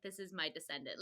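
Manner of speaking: low, soft disappointed grumble; lightly mocking Shepherd King. Friend voicing the Shepherd King about Raven, low unimpressed mutter. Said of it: "he's like".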